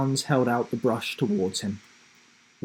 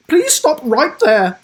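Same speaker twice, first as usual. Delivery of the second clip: high, loud, slow urgent halt; alarmed teacher; 11 dB louder than usual. Acted. Teacher halts grandma, loud high alarm. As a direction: stop her in alarm. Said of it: "Missus Barnes interrupted quickly".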